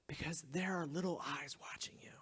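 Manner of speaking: soft unvoiced warning; whisper. Real, not acted. Pastor to parents, whispered reminder. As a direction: hush it like a secret warning.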